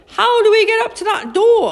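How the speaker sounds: high, loud, urgent question; alarmed question. A narrator's reading. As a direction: call out in puzzlement. Kipper puzzles at high doorway.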